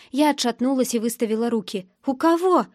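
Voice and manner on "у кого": ordinary delivery, nothing marked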